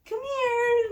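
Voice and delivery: husband sweet-talking the cat; cooing at a pet. Retold or imitated. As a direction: coo it like calling a kitty.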